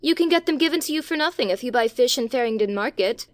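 put-on fast, high boyish excuse; rushing to smooth it over; hasty boy Robert. A narrator's reading. Robert hurriedly excusing himself to the Samiad, fast.

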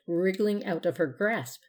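ordinary delivery, nothing marked

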